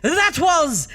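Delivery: high, loud, hugely drawn-out title; booming sign-off. Acted. Host closing the letters segment, booming and drawn out. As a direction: hold the final word in a long ringing cry.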